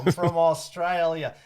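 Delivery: slow, loud mock Australian accent. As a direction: put on a drawn-out Australian twang. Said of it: "Australia or something".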